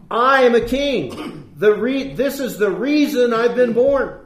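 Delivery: preacher's rising fervor; fervent preaching. Acted. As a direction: preach it out with full conviction. Preaching the dialogue at full force.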